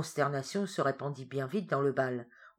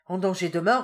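repeated urgently; 3 semitones higher, 8 dB louder than usual. A narrator's reading. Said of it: "répéta le curé".

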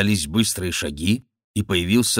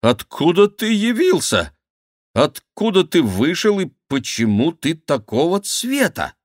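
high-pitched, incredulous questions; astonished questioning; 9 semitones higher, 3 dB louder than usual. Acted. King asks his discoloured friend what happened, astonished and high. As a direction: fire questions in a high, amazed voice.

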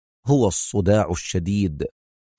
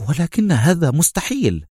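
usual speaking voice

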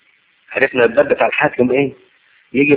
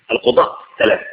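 القضاء ثلاثة whispered, voiceless; whispered repeat. Shown as a demonstration. Preacher repeating القضاء ثلاثة to the congregation in a whisper.

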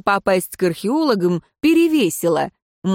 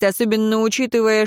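usual speaking voice

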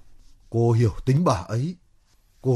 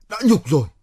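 rising, louder aggrieved protest; indignant outburst; 4 semitones higher, 4 dB louder than usual. Acted. Man protesting a slur over his lodging, indignant. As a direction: throw it back, pitch leaping up.

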